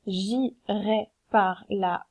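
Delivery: very slow, syllables separated; demonstrating the count slowly; slow scansion demo. Shown as a demonstration. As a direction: spell out each syllable slowly. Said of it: "Je vous fais la démonstration".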